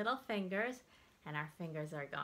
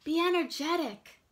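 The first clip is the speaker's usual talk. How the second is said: energetic; 10 semitones higher, 10 dB louder than usual. Acted. Reading feeling words with expression.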